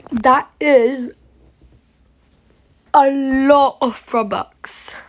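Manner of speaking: loud, slowed-down amazement; amazed. Real, not acted. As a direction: gasp it out in wonder. Seeing huge Robux amount.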